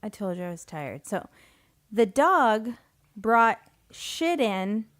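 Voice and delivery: ordinary talk, nothing marked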